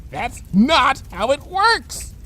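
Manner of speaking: slow, high exasperated correction. Acted. Bon Bon correcting Lyra slowly, fed up. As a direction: spell it out, fed up.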